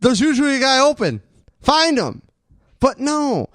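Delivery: ranting. Real, not acted. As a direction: fire it off like a frustrated fan. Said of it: "'cause you were ranting".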